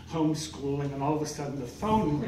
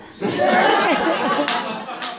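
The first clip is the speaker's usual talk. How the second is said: much higher, louder, very slow drawn-out fresh; gushing mock praise; 9 semitones higher, 5 dB louder, much slower than usual. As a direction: stretch fresh out admiringly. Gushing over denim outfit.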